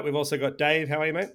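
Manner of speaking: normal talking voice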